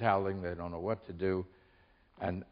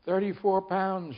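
high, slow, drawn-out bird cry; stretched high parting shriek; bird's shriek; 10 semitones higher, slower than usual. A narrator's reading. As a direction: screech it out like a storybook bird. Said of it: "shrieked the bird".

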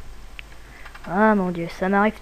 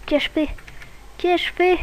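panicked; 8 semitones higher, slower than usual. Acted. Regretting, monsters coming.

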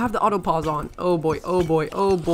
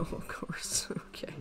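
whispered aside with a laugh; a breathy whispered chuckle; 13 dB quieter, slower than usual. Real, not acted. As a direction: hush it, amused. Quiet aside after finding loot.

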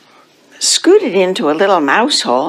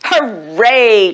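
mildly raised, drawn-out cheer; light cheer; 3 semitones higher than usual. A narrator's reading. Cats cheering the new rule, lightly gleeful. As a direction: cheer it with contained glee. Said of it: "cheered the cats".

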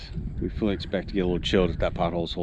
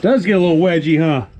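higher and louder, half laughing; loud exclamation; 9 semitones higher, 9 dB louder than usual. Real, not acted. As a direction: call it out loud, amused. Repeats it from the tight squeeze.

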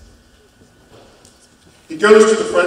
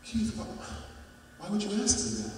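higher, much softer motherly coaxing; mother softly asking her son what's wrong; soft worried mom; 6 semitones higher, 15 dB quieter, faster than usual. Retold or imitated. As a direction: gently coax the hurt child. Mother shaken by son's question.

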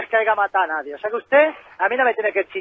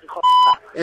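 angry; 4 dB louder than usual. Real, not acted. Furious caller trading insults.

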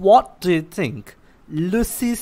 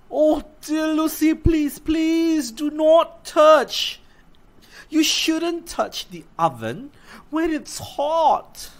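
a higher, gently pleading mother's voice; lightly voiced mother; 12 semitones higher, 4 dB louder than usual. Mother warning little Lucy off matches, gently higher. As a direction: warn your little girl kindly, a bit higher.

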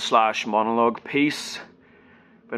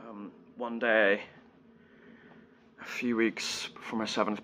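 hushed, grave confession with long pauses; subdued, heavy-hearted storytelling; sombre monologue; 8 dB quieter than usual. Acted. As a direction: recount a childhood loss softly and haltingly. Monologue turns to the loss.

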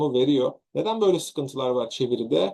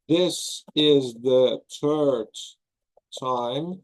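slow model reading; much slower than usual. Shown as a demonstration. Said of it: "teacher models the English sentence".

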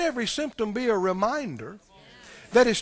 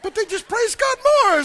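higher, fretful devil voice; a lightly voiced devil who cannot win; frustrated devil; 7 semitones higher than usual. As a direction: dither as the baffled enemy. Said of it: "And he goes".

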